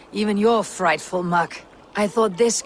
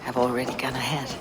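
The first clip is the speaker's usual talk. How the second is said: hushed whisper; 4 semitones lower, 5 dB quieter, faster than usual. Acted. Quiet aside leaving the feast.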